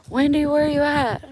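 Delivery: high, loud call across the yard. Retold or imitated. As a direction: shout her name to find her. Dad calling for her.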